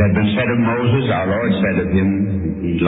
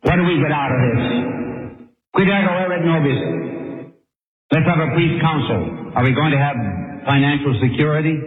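higher, quick grumbling questions; self-interested Peter; 6 semitones higher, faster than usual. Acted. Preacher voicing Peter asking what disciples get, quick high grumble. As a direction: press for the payoff, pitched up and brisk. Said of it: "what did Peter say?".

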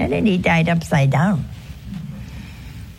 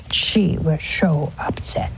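hushed, whispered; 4 semitones lower, 5 dB quieter, slower than usual. Retold or imitated. Priest confiding about an upset widow, whispered. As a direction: lean in and whisper it.

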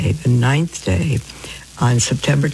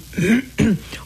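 short throat clear; 9 semitones higher than usual. Real, not acted. Host pauses while thanking callers, clearing her throat. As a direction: clear the throat mid-sentence.

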